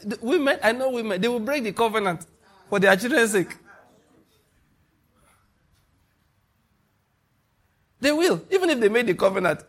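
very high, quick and insistent; fiery rebuttal. Real, not acted. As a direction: brush the claim aside with heat.

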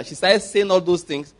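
ordinary talk

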